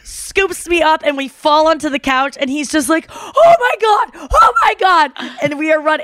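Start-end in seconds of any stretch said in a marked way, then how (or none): 3.1-5.1 high, drawn-out squeals of disbelief; overjoyed screaming. Retold or imitated. Boyfriend scooping up his girlfriend on the couch, overjoyed screaming. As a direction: act out an overwhelmed, joyful shriek. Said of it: "And then he screams".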